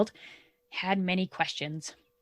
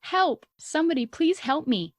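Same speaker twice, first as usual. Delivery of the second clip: high, mild mock cry for help; quoted holler, half-hearted; retold hollering; 8 semitones higher than usual. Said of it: "that he was there hollering".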